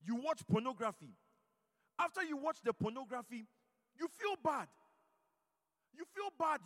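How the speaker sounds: loud, driving sermon delivery; fiery preaching. Acted. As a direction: preach it hard to the congregation. Preaching at full force.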